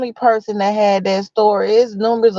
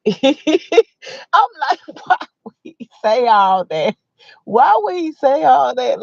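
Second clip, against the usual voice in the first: laughing disbelief; 6 semitones higher than usual. Retold or imitated. Acted shocked reaction.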